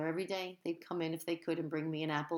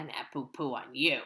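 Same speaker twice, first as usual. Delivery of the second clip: mock raspberry; 4 semitones lower than usual. A narrator's reading. Teasing readers.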